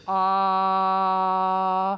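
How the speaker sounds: long, loud, steady "ah"; full open vowel tone; sustained vocal tone. Shown as a demonstration. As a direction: take a breath and hold an open "ah". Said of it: "take a breath and just go".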